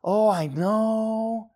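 exaggerated soft-spoken imitation, very slow; mock soft effeminate. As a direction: mimic soft talk, stretching every word. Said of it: "to speak soft".

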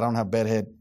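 normal talking voice

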